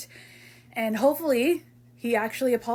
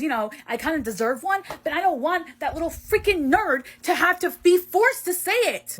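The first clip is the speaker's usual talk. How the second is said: loud, indignant venting, pitched up; angry rant; 4 semitones higher, 3 dB louder than usual. Acted. Venting to a friend about the forced apology, angry rant. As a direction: rant hotly, voice climbing.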